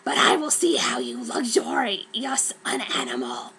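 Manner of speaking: whispered creature voice; Brom's voice. Acted. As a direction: whisper it slyly. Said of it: "Brom said".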